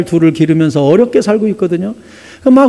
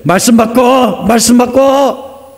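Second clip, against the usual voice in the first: insistent repetition; 5 semitones higher, much slower than usual. Acted. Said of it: "성령님 계속".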